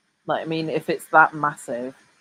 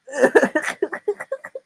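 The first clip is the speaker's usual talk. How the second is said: laughing; 11 semitones higher, 5 dB louder than usual. Real, not acted. Reacting to the clip.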